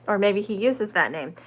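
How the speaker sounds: ordinary delivery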